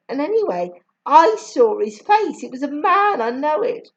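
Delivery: put-on a girl insisting she saw a man; Charmian, insistent. A narrator's reading. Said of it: "Charmian said".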